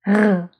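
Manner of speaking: "R" as a rasped uvular sound; French throat R. Shown as a demonstration. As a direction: roll "R" at the back of the throat. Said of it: "it comes from the throat".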